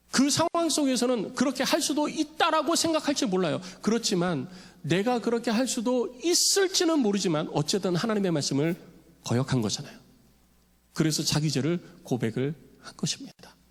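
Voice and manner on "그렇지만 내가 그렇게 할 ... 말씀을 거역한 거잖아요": ordinary talk, nothing marked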